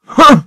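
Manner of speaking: a sharp, high scornful grunt. Acted. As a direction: huff at him loudly. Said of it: "grunted Sir Handel".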